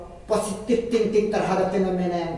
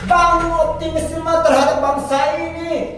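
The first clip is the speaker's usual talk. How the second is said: angry shout; 7 semitones higher, 5 dB louder than usual. Real, not acted. Panelist shouting down the other guest, furious and loud. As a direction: hammer each word at the opponent like a verdict.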